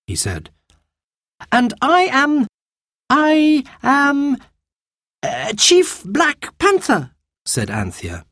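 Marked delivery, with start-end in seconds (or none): 1.5-7.1 put-on high, hesitant child improvising; stall, then announce it slowly; thinking quickly. A narrator's reading. Anthea invents a chief's name on the spot, hesitant. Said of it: "thinking quickly".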